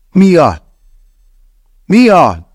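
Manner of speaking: high, loud shout of her name; desperate shout. A narrator's reading. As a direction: scream her name into the dark. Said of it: "üvöltöttem".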